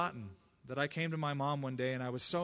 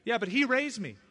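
raised, higher and louder objection; protesting; 8 semitones higher, 9 dB louder than usual. Retold or imitated. Young man arguing with the Lord, loud and indignant. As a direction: push back hard, indignant.

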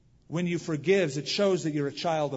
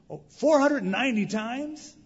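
high, loud, slow disbelief; astonished Peter; 9 semitones higher, 6 dB louder than usual. Retold or imitated. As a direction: gasp at the huge number like a stunned Peter. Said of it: "I can picture old Peter".

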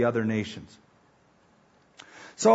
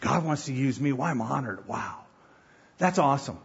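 softer, hushed wonder at being chosen; humble awe; 4 semitones lower, 6 dB quieter than usual. Retold or imitated. Believer amazed God would choose them, hushed awe. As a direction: say it like a grateful newcomer, almost under your breath. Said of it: "you start out going".

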